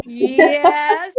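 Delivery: an eager horse pleading yes with a whiny catch; begging animal voice. Retold or imitated. Horse begging the rider for cookies, mock-whiny yes. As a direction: play the horse begging for cookies, voice close to crying. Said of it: "They're like".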